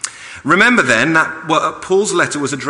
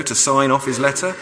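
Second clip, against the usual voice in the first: ordinary talk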